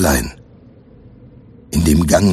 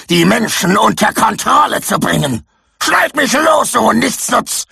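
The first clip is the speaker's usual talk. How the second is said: barking orders; 13 semitones higher than usual. Acted. Victorius shouting at Jack, high furious commands. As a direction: snap at your useless servant. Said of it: "herrschte Victorius Jack an".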